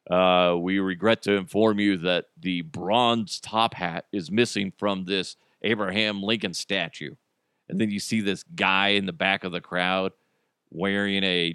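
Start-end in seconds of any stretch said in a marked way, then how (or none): 0.1-7.1 low, even official announcement; police spokesman. Acted. Police tell reporters of the missing hat, solemn official voice. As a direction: deliver it as solemn press-conference news. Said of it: "they have, like, a press conference".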